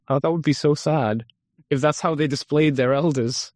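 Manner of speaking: ordinary delivery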